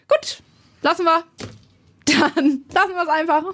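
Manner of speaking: high-pitched, pouty giving up; retold sulky surrender; mock resigned huff. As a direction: drop it with exaggerated hurt. Acts own resigned reply to husband.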